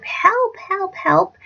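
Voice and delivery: high, repeated mock cries; retold shout. Girl shouting for rescue, high restrained mock shout. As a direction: mimic calling out, without the volume. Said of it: "because I shouted".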